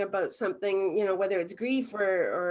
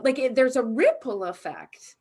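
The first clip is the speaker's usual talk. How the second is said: ordinary delivery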